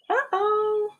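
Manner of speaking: high, sing-song uh-oh; a cartoonish oops for the kids; playful uh-oh. Refrain voiced by book character.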